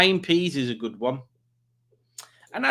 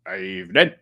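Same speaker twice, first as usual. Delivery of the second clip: low, deadpan evening; lower-pitched mock greeting; reading out Dave's hello in a low voice; mimicking Dave; 6 semitones lower, 3 dB louder than usual. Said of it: "Like that".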